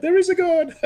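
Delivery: high-pitched imitation of a relieved wife; hammed-up high exclamation; overjoyed wife. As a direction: mimic a wife's jubilant relief. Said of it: "you should have seen my wife".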